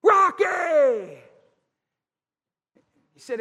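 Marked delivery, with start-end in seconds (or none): 0.0-1.2 loud yell across a parking lot; hollering a name. Retold or imitated. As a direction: call him out, shouting up high. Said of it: "out there in the parking lot hollering".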